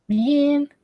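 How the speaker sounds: a long, very high drawn-out "man" of dismay; high anguished wail. Real, not acted. Reacting to the last page.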